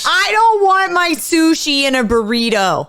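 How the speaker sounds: high, loud, slow indignant protest; outraged. Real, not acted. Heated playful sushi argument.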